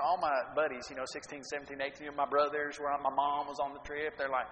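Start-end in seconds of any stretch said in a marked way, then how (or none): none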